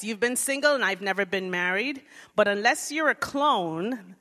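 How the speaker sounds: normal talking voice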